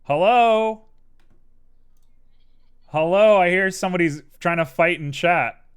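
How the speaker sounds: loud, high hello into the call. Real, not acted. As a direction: call out loudly to get attention. Calling out in voice chat.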